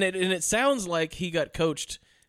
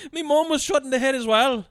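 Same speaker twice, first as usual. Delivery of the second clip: higher, louder stage brogue; cartoon Irish accent; 8 semitones higher, 4 dB louder than usual. Riffing on the Irish accent, loud mock brogue. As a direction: moan it in thick mock Irish. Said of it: "god-awful accent".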